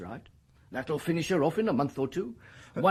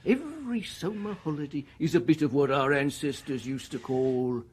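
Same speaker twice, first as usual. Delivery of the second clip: ordinary delivery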